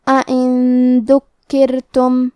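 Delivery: drawn-out recited Arabic, doubled kaf in ذکرتم held; slow tajweed recitation. Shown as a demonstration. Teacher demonstrates tajweed to students, slow recitation. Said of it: "کاف پہ تشدید ہے تو ہم دو حرف".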